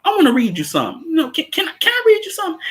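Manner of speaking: high squeaky mock voice asking politely; mock character voice. Voicing a character's request.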